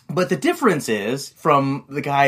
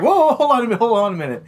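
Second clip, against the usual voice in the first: high, louder "whoa, wait" imitation; mock urgent interjection; 9 semitones higher, 3 dB louder, much faster than usual. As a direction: act out hastily stopping someone. Jeff calming the furious salesman.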